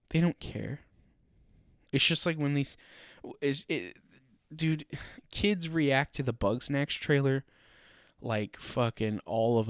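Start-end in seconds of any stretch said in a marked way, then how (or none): none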